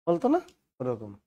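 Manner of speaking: ordinary talk, nothing marked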